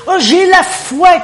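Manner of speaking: high, loud, drawn-out boast of faith; sarcastic proud believer; mock bold claim. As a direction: ham up a showy claim of faith.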